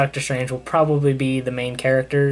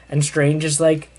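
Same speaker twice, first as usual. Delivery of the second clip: ordinary delivery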